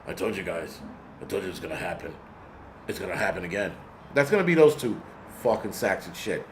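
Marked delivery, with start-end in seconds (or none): 0.1-3.7 crazy-eyed stare. Retold or imitated. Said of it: "staring into the camera with his crazy eyes".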